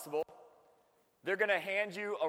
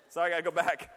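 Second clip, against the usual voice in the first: ordinary talk, nothing marked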